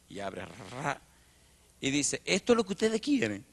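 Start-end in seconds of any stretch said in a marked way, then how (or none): 2.2-3.4 put-on cocky kid. Boy showing his paper.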